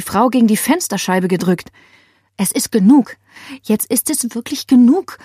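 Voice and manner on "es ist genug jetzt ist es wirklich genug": plain reading voice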